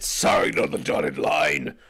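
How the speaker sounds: very deep, slow demon voice; low slow hellish offer; demonic Satan voice. Pretend. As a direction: growl it like the devil from a lava pit. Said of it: "You pull out ... some lava pit".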